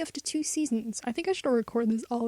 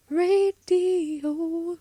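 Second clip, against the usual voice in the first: higher; 6 semitones higher than usual. Pretend. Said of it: "'cause this is radio".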